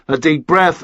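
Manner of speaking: ordinary delivery, nothing marked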